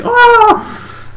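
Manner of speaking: high, loud comic shriek; mock scream. Car sees the crash, mock scream. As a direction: shriek as the panicked driver. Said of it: "the other car's all like".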